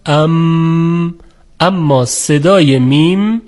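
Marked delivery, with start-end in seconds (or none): 0.0-1.2 "ام" with lips closed into a long, held hum; demonstrated mim sound. Shown as a demonstration. Teacher showing how the letter mim is pronounced, lips-closed humming "ام".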